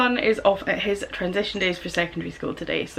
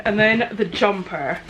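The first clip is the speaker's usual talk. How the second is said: ordinary talk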